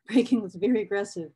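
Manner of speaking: quick, laughing echo; laughing repeat. Real, not acted. Echoing the quote to viewers, laughing. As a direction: toss the line again through a laugh.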